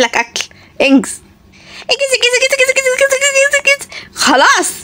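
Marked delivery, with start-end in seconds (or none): 1.9-3.9 put-on high, urging child voice; high impatient kid chant. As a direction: hurry the grown-up like a kid.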